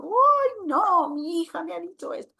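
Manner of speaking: high, loud, wailing imitation of the hurt mother; mock tearful mother. Lecturer imitating the mother leaving in tears, high mock wail. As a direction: ham up the mother's hurt lament. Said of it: "La mamá se fue llorando".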